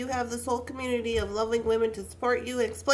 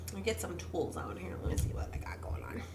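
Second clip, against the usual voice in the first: quiet whisper while rummaging; whispered to herself; 4 semitones lower, 6 dB quieter than usual. Real, not acted. Crafter getting her tools out, whispering to herself. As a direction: whisper under the breath, busy hands.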